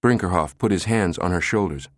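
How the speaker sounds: usual speaking voice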